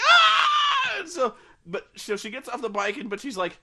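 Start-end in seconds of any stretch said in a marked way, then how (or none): 0.0-1.0 much higher, loud mock shriek; mock scream. Bella startled by the ghost, loud high shriek. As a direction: let out a startled hammed-up shriek. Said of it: "And she's like".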